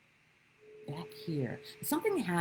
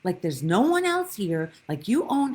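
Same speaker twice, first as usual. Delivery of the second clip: retold angry rebuke; 3 semitones higher, 6 dB louder than usual. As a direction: scold her like an irate neighbour at the pool. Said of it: "Yeah, he was angry".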